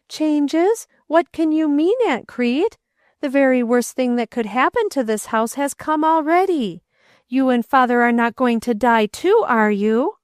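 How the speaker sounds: put-on a frightened boy fearing loss; anxious boy. A narrator's reading. Boy voiced in story.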